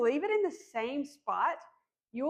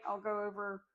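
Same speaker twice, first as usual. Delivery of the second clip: ordinary delivery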